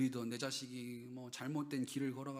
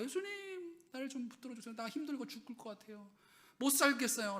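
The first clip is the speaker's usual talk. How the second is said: high pleading cry, mildly acted; crying out to Jesus; 9 semitones higher than usual. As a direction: beg Jesus for help, voice straining up. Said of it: "울부짖고 예수님을 찾고".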